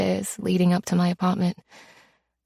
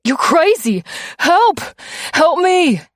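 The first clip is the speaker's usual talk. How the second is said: much higher, louder cries for help; panicked screaming; 10 semitones higher, 6 dB louder than usual. Acted. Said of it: "he starts screaming".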